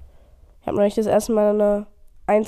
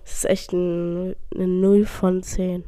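slow; 2 semitones lower, much slower than usual. Real, not acted. Slow harsh rating.

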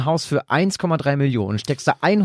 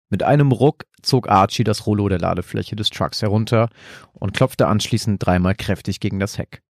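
calm storyteller reading aloud; story narration. A narrator's reading. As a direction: tell it like a bedtime horror tale. Reads out his horror story.

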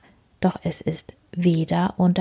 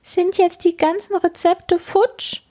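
much higher anxious bug voice; worried beetle; 11 semitones higher than usual. Acted. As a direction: fret in a tiny voice.

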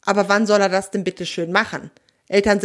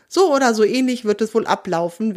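ordinary delivery, nothing marked

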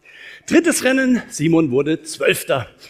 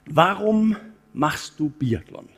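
slow and deliberate, each word weighed; slow, pointed question; much slower than usual. Retold or imitated. Coach reads his text message to athlete, slow and deliberate. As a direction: ask it slowly to make him think. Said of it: "ich habe gesagt".

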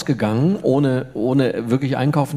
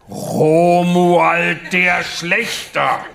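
very slow, higher and louder proclamation; pompous knight repeating himself louder; insistent knight voice; 5 semitones higher, 5 dB louder, much slower than usual. Acted. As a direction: hammer the name home, grandly. Knight insists on his name.

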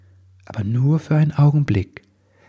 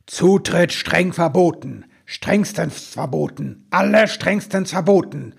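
thundering; 7 semitones higher than usual. A narrator's reading. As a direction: hammer out each prohibition with mounting severity. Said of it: "donnerte die Stimme". (streng donnernd, langsam und gehoben)

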